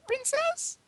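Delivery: high-pitched questioning servant voice; high servant's query; servant to a princess. Acted. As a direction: ask it high, as a servant to royalty. A servant calls her mistress.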